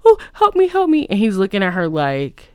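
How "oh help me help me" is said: high-pitched hammed-up cries for help; playful damsel-in-distress yelps, high and loud; mock plea for help. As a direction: squeal for rescue in a high mock panic. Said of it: "she's asking Ernest for help".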